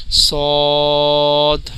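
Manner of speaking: صاد heavy and rounded, very long, ending in d; full and thick. Shown as a demonstration. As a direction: stretch a thick صاد slowly to its d. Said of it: "دال کی آواز ادا ہوتی ہے".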